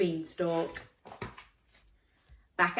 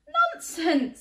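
giant's wife; 12 semitones higher, 6 dB louder than usual. Acted. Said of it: "said his wife".

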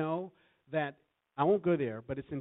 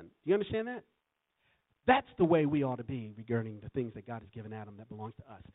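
normal talking voice

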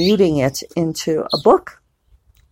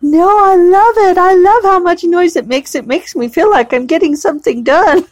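much higher, louder, bright put-on voice; gushing friend; 12 semitones higher, 4 dB louder than usual. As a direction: play a friend in love with her noisy keyboard. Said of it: "And she's like".

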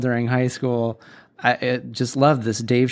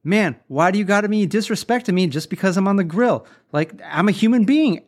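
higher, aggrieved complaint; mock indignant; 7 semitones higher than usual. Cook complaining to his coworker, mock indignant. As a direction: play up the hurt pride of the disrespected cook. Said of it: "the main guy goes".

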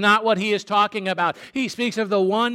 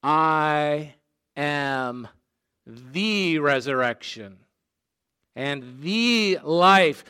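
each "the" drawn out as "thee"; slow, separated words, leaning on both "the"; stress on THE; 3 semitones lower, much slower than usual. Retold or imitated. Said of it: "Jesus is specific".

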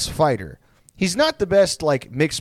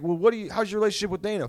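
fast, higher-pitched interviewer; Ariel Helwani; 6 semitones higher, 4 dB quieter, much faster than usual. Retold or imitated. Interviewer pressing a reluctant fighter, quick and high. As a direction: hurry the question in. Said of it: "he's like".

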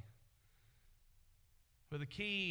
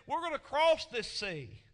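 louder, higher firm promise; Jesus reminding firmly; 9 semitones higher, 8 dB louder than usual. Acted. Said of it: "he reminds them that he said".

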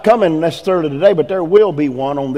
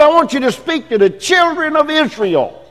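higher proclaiming voice of the Lord; preaching; 11 semitones higher than usual. Acted.

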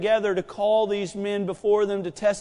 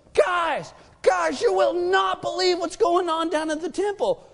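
higher, urgent calling out; excited shout; 7 semitones higher than usual. Retold or imitated. Messenger bursting in to tell the council, excited shout.

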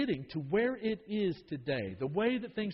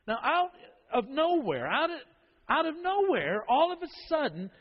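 higher and louder, pressing the point; preaching emphasis; 8 semitones higher, 4 dB louder than usual. Real, not acted. Preacher to congregation, rising emphatic call. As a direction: hit out of nowhere hard from the pulpit.

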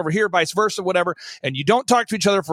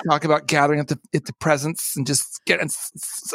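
mock lisping worship leader; 6 semitones lower than usual. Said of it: "he said; exclusively spoke in the tss".

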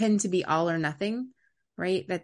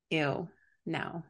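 imitated distaste; 7 dB quieter than usual. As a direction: imitate a picky child quietly turning it down. Retold picky child's refusal.

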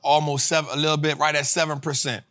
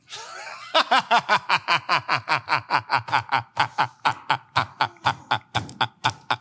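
long, rhythmic laughing fit; 3 semitones lower than usual. Real, not acted. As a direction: laugh on and on in steady pulses. Laughs after saying stick to plan.

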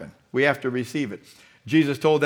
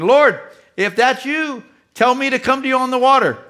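loud, high-pitched plea across the water; Peter calling to Jesus; 9 semitones higher, 6 dB louder than usual. Acted. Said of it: "Can you imagine Peter saying".

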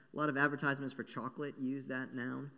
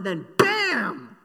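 loud, higher smack of the trap; sound-effect bam; 8 semitones higher, 13 dB louder than usual. Acted. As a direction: slam it like a trap snapping. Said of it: "a little bit more sound effecty".

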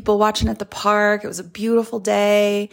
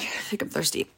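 whispered aside; 2 semitones lower, 10 dB quieter, faster than usual. Real, not acted. Aside after yawning.

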